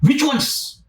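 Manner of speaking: high, eager repeated question; eager excitement. Retold or imitated. Rich man eagerly pressing Jesus, high and keen. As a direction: pitch it up like he can't wait. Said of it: "Look at the tone".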